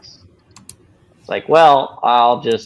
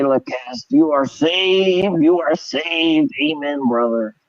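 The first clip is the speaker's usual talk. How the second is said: mock pious preacher; 9 semitones higher than usual. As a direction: ham up a caring pastor saving souls. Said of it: "this whole pious presentation of".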